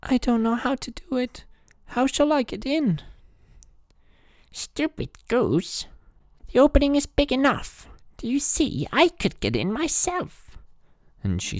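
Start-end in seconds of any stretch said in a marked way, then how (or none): 0.0-3.0 put-on high, sorrowful young girl; little girl voice. A narrator's reading. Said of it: "Gretel perceived her intention and said". 4.5-10.6 put-on high, cackling old witch. A narrator's reading. Witch voiced in the story.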